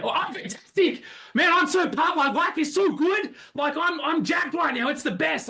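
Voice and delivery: very high, bursting with energy; over-the-top hype. Acted. Coach showing how to break rapport, over-the-top hype. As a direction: gush as if absurdly pumped. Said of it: "here's how you instantly break rapport".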